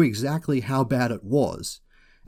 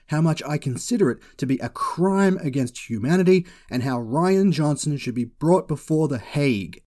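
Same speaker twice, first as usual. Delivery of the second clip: normal talking voice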